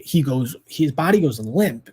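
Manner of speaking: ordinary talk, nothing marked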